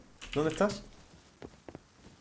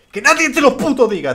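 loud, higher cry in the pep talk; emphatic shout; 8 semitones higher, 10 dB louder than usual. Pretend. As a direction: punch it out as the climax.

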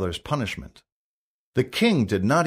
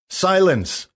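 high, loud one-word order; shouted command; 8 semitones higher, 5 dB louder than usual. A narrator's reading. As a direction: cut him off with a shout. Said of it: "shouted Hendon".

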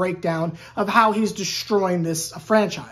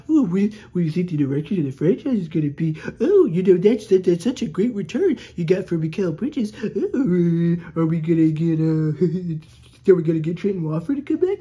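low, fawning mock reporter voice, fast; mocking press reporters; 4 semitones lower than usual. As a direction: ham up a sycophantic journalist, lower pitch. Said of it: "they were asking him just the silly stuff".

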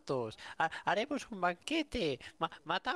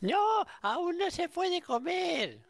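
upset shout; 9 semitones higher, 4 dB louder than usual. Real, not acted. Animal eats the farm ducks.

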